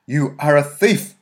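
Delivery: loud, slow, stern accusation; avenging angel. A narrator's reading. Mary accusing the boy thief, loud and stern. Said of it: "in a voice like that of an avenging angel".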